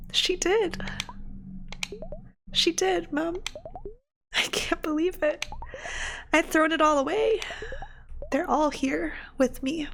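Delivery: high voice, close to tears; moved, tearful mother. Acted. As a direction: speak high and disbelieving, holding back tears. Plays mother, moved by news.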